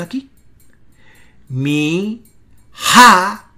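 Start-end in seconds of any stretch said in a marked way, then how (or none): 1.5-3.5 deliberate Mi, then a loud emphatic ha; stress on HA. Shown as a demonstration. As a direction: separate the syllables and hit ha hard. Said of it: "noten eso".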